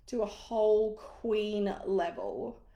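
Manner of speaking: slow, drawn-out "queen level"; emphatic. Real, not acted. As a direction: savour "to a whole queen level".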